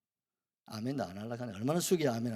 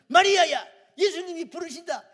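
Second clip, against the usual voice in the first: a loud, much higher shout of the name, falling hard at the end; bursting through the door, yelling for her sister; bold Martha shout; 14 dB louder than usual. Retold or imitated. Martha bursts in yelling for Mary, a loud high shout. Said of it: "이렇게 나가야 이 마르다 스타일이거든".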